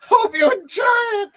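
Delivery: very high, crying mimicry; mock tearful shout. Viewer mimicking the show for the kids, high mock wail.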